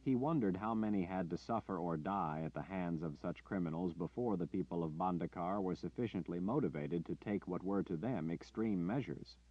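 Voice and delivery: usual speaking voice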